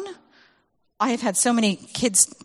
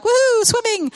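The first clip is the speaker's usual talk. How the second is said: high, loud whooping "Woohoo"; imitating excited children; kids cheering; 14 semitones higher, 5 dB louder than usual. Kids cheer about swimming.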